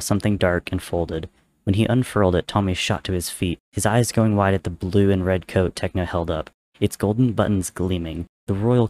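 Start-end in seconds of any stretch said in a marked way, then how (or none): none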